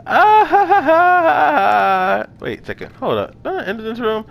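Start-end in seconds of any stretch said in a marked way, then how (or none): 0.1-2.3 high, loud cackling laugh; triumphant laugh. Real, not acted.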